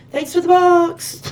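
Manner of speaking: high, loud gleeful thief voice; imagined thief. Pretend. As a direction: crow it like a cheeky thief grabbing the box. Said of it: "then the person going".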